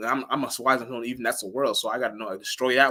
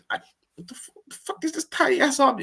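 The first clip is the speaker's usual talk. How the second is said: pitched way up, fast, baffled squeak; high incredulous mock voice; 14 semitones higher than usual. Imitating someone handed a tiny object, high and baffled. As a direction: act baffled by a useless gift.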